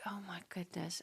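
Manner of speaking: breathless whispered gasp of dismay; shocked whisper. Retold or imitated. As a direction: whisper it in disbelief. Mother shocked by son's injury.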